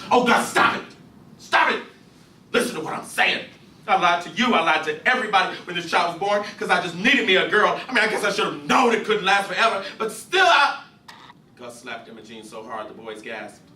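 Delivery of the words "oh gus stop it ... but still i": high, urgent confession from the mother; a frantic wife blurting out the truth to her husband; pleading mother. Acted. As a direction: beg him to stop and listen. Imogene voiced, confessing.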